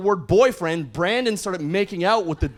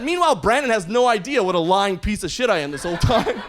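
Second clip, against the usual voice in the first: ordinary talk, nothing marked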